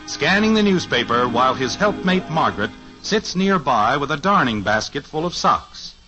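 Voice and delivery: ordinary talk, nothing marked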